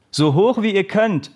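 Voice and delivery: high-pitched shouted coaching command; coach calling out. A narrator's reading. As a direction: shout it to the team. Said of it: "rief der Trainer".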